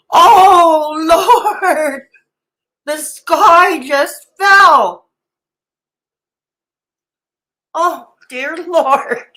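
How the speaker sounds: slow, high, melodramatic disbelief; milk the fake scandal word by word; mock shock. Reactor mocking fuss over fifty bucks, hammed-up shock. Mock horror at trivial donation news.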